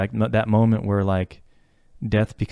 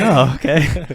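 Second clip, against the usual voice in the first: laughing; 6 semitones higher, 9 dB louder, slower than usual. Retold or imitated. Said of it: "I'm like".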